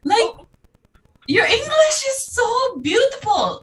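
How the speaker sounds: high mock Filipino accent, teasing. Mocking Filipinos who ask when she came here, in a high accent. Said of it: "they ask me in an accent".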